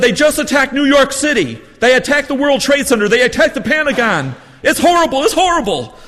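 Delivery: put-on high excited New England accent shout. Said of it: "He has this wonderful New England accent".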